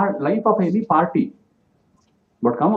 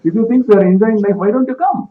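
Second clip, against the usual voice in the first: raised, louder, quick playful challenge; cheeky dare, pitched up and fast; teasing retort; 6 semitones higher, 6 dB louder, faster than usual. Retold or imitated. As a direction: toss the invitation back with a grin. Witty Swami teasing a critic.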